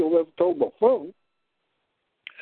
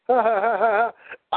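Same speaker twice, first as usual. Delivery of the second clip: mocking laugh; 4 semitones higher than usual. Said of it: "and he just laughs".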